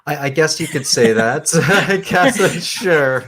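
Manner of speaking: tickled, going along with the joke; amused, laughing. Real, not acted. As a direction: laugh through a half-joking agreement.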